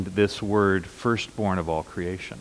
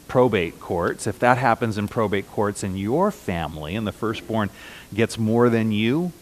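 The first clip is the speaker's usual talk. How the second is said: usual speaking voice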